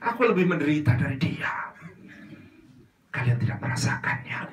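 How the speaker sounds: ends in a whisper; self-pitying sufferer. Acted. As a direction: claim the bigger pain. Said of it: "susah yang begini-begini".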